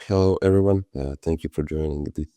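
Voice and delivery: normal talking voice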